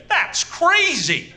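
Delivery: high, slow, shocked mother's cry; mother's outburst. Retold or imitated. Mother quoting the pay, high and slow. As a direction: play mum's high-pitched disbelief. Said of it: "and she went".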